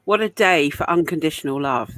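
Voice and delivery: ordinary talk, nothing marked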